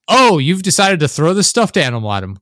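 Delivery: exaggerated sarcastic surprise; sarcastic amazement. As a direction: hail a terrible decision. Sarcastic reaction to stupid choice.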